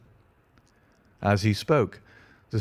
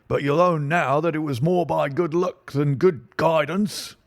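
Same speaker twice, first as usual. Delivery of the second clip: pompous inspector; 6 semitones higher than usual. Acted. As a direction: talk down to him with bluster. Inspector condescending to Holmes.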